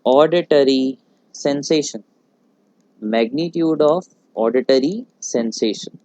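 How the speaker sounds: very slow, word by word, for note-taking; slow and clear dictation of an English term. Shown as a demonstration. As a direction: spell the definition out for students writing it down. Teacher dictating definition slowly.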